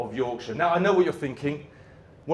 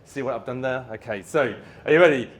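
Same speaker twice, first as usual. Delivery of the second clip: ordinary talk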